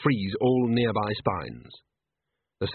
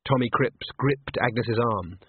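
usual speaking voice

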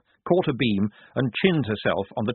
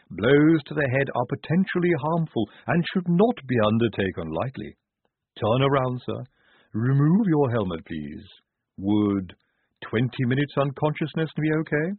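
measured, precise character voice; mildly raised, polite medical patter; clinical Igorina; 3 semitones higher than usual. A narrator's reading. Igorina gives formal medical warning.

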